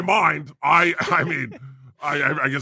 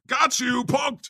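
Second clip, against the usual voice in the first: high, gleeful mocking yell; taunting shout; 9 semitones higher, 3 dB quieter, much slower than usual. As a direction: crow it like a prankster. God voice taunting humans.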